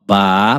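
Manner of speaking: "ba" as a lone, drawn-out syllable. Shown as a demonstration. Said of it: "vamos separar bainha?". ("ba" isolado, sílaba por sílaba)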